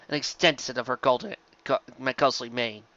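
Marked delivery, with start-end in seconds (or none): none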